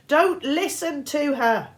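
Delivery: high, loud slow shout. A narrator's reading. Girl shouting from the statues to Percy, loud. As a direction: yell the warning across the garden. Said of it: "Annabeth's voice shouted".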